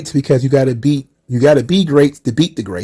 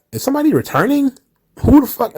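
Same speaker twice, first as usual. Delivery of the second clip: retold shock; 11 semitones higher, faster than usual. Own reaction retold, high, fast.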